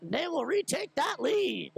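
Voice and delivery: slow, stretched words, pitched high; triumphant goal announcement. Real, not acted. Hockey announcer telling fans the Hornets retake the lead, triumphantly. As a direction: draw each phrase out high over the celebration.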